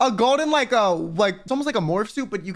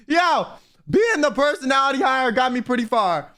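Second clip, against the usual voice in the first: high-pitched, just after laughing; amused; 5 semitones higher than usual. Real, not acted. Storyteller joking about being personality hire, amused. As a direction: say it still tickled, pitch up. Still amused by own joke.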